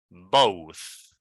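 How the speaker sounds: "both" said slowly, falling, th held at the end; final th in both. Shown as a demonstration. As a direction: let the th of "both" hiss on. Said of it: "pay attention to the sound here".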